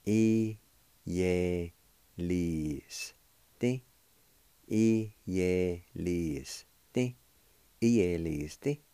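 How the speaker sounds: I-ye-lis-ti said syllable by syllable, clearly; careful pronunciation demo. Shown as a demonstration. Teacher modelling I-ye-lis-ti for students, careful and clear. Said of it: "It's pronounced like this".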